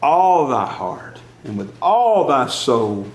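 measured, solemn reading of the verse, each phrase slow; slow scripture recitation. Acted. Preacher to congregation, reciting the commandment slowly. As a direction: lay each phrase down slowly like holy writ.

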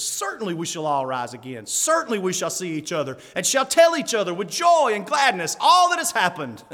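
rising to a loud, high, joyful cry; half laughing, enthusiastic. Retold or imitated. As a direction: swell with gladness as the preacher answers. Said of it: "half laughing, half enthusiastic".

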